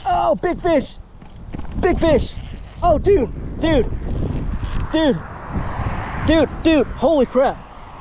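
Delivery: high excited yells; excited shouting. Real, not acted. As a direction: shout at the big bite. Huge fish on the line.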